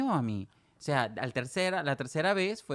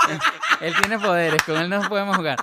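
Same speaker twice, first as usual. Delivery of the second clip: loud voice breaking into laughter; laughing protest; 4 semitones higher, 9 dB louder than usual. Retold or imitated. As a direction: imitate protesting friends while laughing. Laughing while quoting friends' protest.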